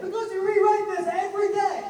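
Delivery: very high, full-volume shout; exasperated yell. Retold or imitated. Actor snapping back at the show's creator, exasperated yell. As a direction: yell it across the room at the booth. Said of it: "And I did this".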